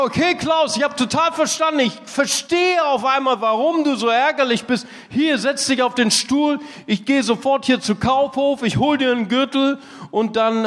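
high-pitched, keen reassurance; eager empathy. Retold or imitated. Pastor tells Klaus he'll fetch a belt, eager and high. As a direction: act out a sudden rush of understanding and help.

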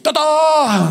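high, loud playful tada; excited tada. Retold or imitated. Boy handing the phone to his father, triumphant. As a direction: announce it with a flourish.

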